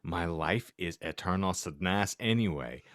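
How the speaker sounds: ordinary delivery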